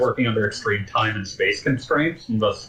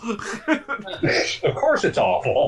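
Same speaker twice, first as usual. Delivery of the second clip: slow, high, laughing drawl; 12 semitones higher, much slower than usual. Real, not acted. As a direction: laugh it out slowly.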